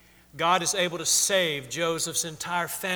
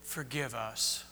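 hushed dying plea; 8 semitones lower, 12 dB quieter, slower than usual. Acted. Brothers quote father's dying wish.

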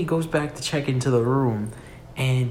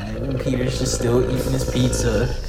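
ordinary talk

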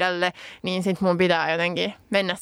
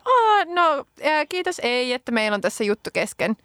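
polite brush-off; 6 semitones higher than usual. Retold or imitated. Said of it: "sanoo niinku sen yhden kerran silleen".

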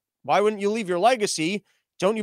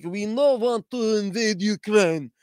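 slow, broken mock Russian accent; a lightly put-on Russian accent, drawn out; slower than usual. Russians talking to Biden, slow mock Russian accent. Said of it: "I can't go back and forth in accents".

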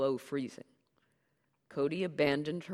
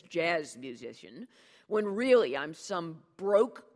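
loud, raging self-mocking rant; vicious tirade; 5 semitones higher, 7 dB louder than usual. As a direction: berate him with biting sarcasm. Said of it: "viciously you berate my brother".

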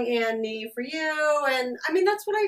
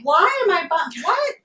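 a higher, louder bewildered cry cut off; playfully voiced shock at her own purchase; mock dismay; 6 semitones higher, 5 dB louder than usual. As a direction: act out the sudden regret of buying small. Voicing herself in tight Spanx.

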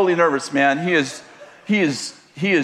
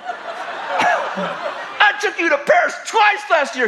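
much higher, slow, laughing mock yell; 13 semitones higher, much slower than usual. Imitating the husband yelling back at his wife, slow and laughing. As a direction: shout it slowly, cracking up. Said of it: "he yells".

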